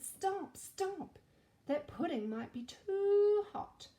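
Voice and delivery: high, loud cry of stop, stop; a storybook queen calling out to halt the king; queen shouting. Acted. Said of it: "but the queen shouted".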